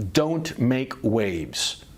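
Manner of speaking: ordinary talk, nothing marked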